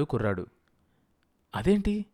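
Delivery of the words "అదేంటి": high-pitched, perturbed question; flustered. A narrator's reading. Wife learns the boy refuses her money, flustered. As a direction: ask it taken aback and uneasy. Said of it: "కలవరపడింది Varudhini".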